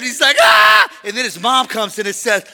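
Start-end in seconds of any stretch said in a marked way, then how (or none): 0.4-0.9 high, loud mock shriek; scared scream. Preacher plays grandson startled by dad, scared scream. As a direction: imitate a frightened toddler's yelp. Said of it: "he gets scared".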